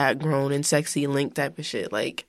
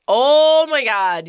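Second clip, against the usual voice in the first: excited; 8 semitones higher, 7 dB louder, much slower than usual. Real, not acted. Excited recognition.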